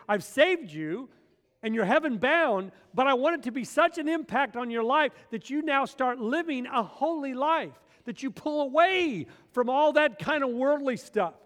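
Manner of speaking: loud, high, slow pulpit voice; full-force preaching. Acted. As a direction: thunder it from the pulpit. Preaching Jesus's words at full force.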